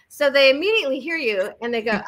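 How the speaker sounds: ordinary delivery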